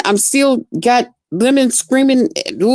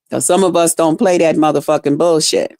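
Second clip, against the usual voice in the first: ordinary talk, nothing marked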